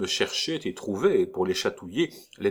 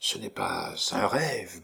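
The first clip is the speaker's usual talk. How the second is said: stammering; 4 dB quieter than usual. Acted. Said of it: "balbutia-t-il".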